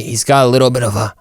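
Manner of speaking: normal talking voice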